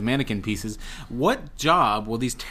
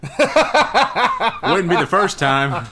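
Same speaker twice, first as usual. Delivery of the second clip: laughing; 4 semitones higher, 4 dB louder, much slower than usual. Real, not acted. Teasing joke through laughter.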